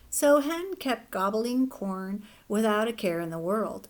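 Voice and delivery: usual speaking voice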